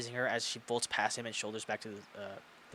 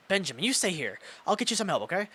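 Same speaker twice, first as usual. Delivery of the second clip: urgent principal; 7 semitones higher, 5 dB louder than usual. Acted. Principal reassuring hurt Ben.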